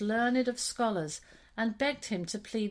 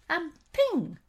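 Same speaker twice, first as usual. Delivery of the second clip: high, louder springy ping; bright sudden ping; ping effect; 7 semitones higher, 5 dB louder than usual. A narrator's reading. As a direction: pop it out like a stitch snapping. Stitch popping sound.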